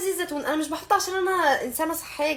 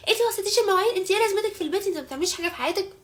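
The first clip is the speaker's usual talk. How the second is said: higher-pitched mock nagging; imitating scolding mother; 5 semitones higher, faster than usual. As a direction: mimic mum's rapid scolding, pitched up. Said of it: "وبكره الصبح هتقول لي". (تقليد أم بتوبخ بنتها)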